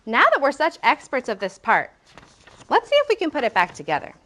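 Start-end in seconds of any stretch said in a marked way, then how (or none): none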